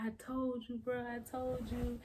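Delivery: high-pitched I-told-you-so voice; imitated cousin. Cousin reacts to twins news.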